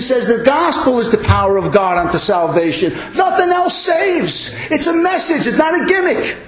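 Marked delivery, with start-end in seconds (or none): 3.2-6.4 rising to a passionate shouted climax; fiery preaching. Real, not acted. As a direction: preach it out like a fired-up pastor. Preaching at full force.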